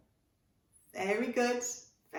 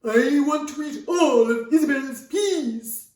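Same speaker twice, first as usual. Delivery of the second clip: loud, high whingy dragon; Gringer the Whinger; 7 semitones higher, 13 dB louder than usual. Acted. Dragon wanting the child's dinner, whinging to Mammy. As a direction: whine the demand out slowly, very loud. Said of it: "He would go to Isabel".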